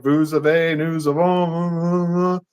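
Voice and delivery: slow French conjugation drone, drawn out; classroom French recital. Retold or imitated. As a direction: drag out the French like a rote pupil. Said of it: "for being able to say".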